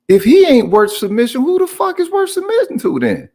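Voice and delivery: high-pitched, incredulous questioning; incredulous challenge. Pretend. Challenging women who fight submission, high-pitched disbelief.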